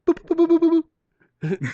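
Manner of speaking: high, loud repeated boops; cartoon mind-control beeping; imitated telepathy signal. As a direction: pulse out silly high sonar boops. Imitates telepathic animal signal noise.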